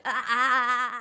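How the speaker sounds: loud high scream with a laugh; big release scream. Shown as a demonstration. Showing the listener the release scream, loud. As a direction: let it all out in one shout. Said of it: "let out a big scream".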